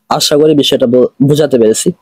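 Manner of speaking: ordinary talk, nothing marked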